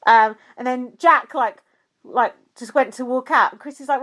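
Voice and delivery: ordinary talk, nothing marked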